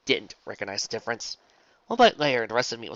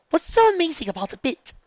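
put-on high, loud haughty girl; 7 dB louder than usual. Weiss character voice.

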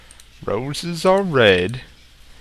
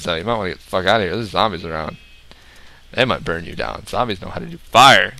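normal talking voice

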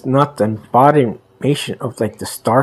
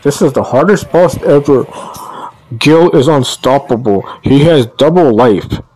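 loud rant; 3 semitones higher, 6 dB louder than usual. Real, not acted. Ranting at the hardest boss.

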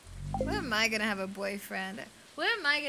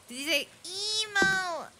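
squeaky mock voice; 10 semitones higher, 6 dB louder, slower than usual. Teasing reaction to emo remark.

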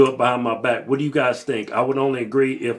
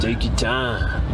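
slow drawl; 3 semitones lower, much slower than usual. Acted.

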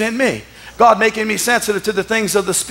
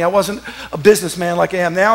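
ordinary talk

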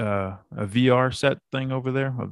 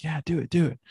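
higher-pitched imitation of excited kids; kids' chant, pitched up; eager kids; 5 semitones higher than usual. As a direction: lightly mimic children egging dad on. Kids egging their dad on.

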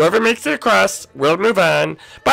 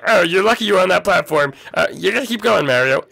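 usual speaking voice

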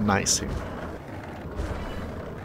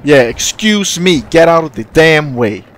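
loud, raised-pitch demand; irritated calling out; 5 semitones higher, 7 dB louder than usual. Real, not acted. Skater telling people blocking him to move, irritated call. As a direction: call out impatiently over the crowd.

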